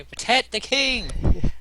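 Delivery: high-pitched, stretched-out rallying shout; [name] shouting. Acted. Player repeats character's order to guard the king, acted shout. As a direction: call out slowly, voice way up. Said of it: "Jim's gonna say".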